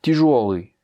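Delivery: "Тяжёлый" punched on the middle syllable жё; stress on yo. Shown as a demonstration. Teacher presenting an adjective to a student, stress on ё. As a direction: hit жё hard in "Тяжёлый". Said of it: "a son accent tonique sur le yo".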